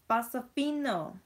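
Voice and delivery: high, loud, slow Pasofino; a silly dramatic pronunciation of Pasofino; odd high drawn-out say. Real, not acted. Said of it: "I don't know why I said it like that".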